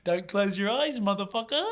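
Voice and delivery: high, playful mock menace; mock threat. To his pranker on the flight back, hammed-up mock menace. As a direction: tease him with a hammed-up threat. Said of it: "I'm like".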